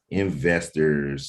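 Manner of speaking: ordinary talk, nothing marked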